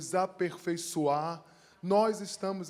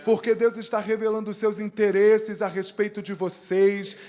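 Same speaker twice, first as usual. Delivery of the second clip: loud, emphatic declaration of blessing; Segenszuspruch; 2 semitones higher, 8 dB louder than usual. Real, not acted. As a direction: proclaim the blessing with force, peaking on the yes. Declares a blessing over the musicians.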